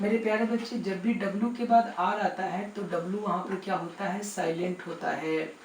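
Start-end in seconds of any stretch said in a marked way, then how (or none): none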